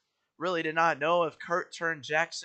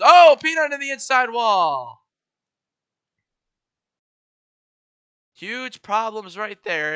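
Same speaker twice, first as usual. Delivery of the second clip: excited; 6 semitones higher, 13 dB louder than usual. Real, not acted. Crash into wall.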